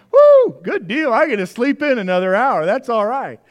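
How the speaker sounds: high whoop then loud cheery relief; gleeful whoop. Retold or imitated. Pastor recalling the extra hour of sleep, loud gleeful whoop. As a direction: whoop like you just won a prize.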